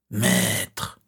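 insidious sibilant call; eerie, haunting inner voice; hissing voice. A narrator's reading. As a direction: hiss it slowly from inside the head. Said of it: "la voix sifflante et lancinante".

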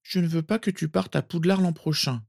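put-on high, plaintive child's voice; murmuring sleepy boy. A narrator's reading. Sirius fighting sleep, begging cousin, murmuring. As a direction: plead like a sleepy little boy. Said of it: "murmura Sirius".

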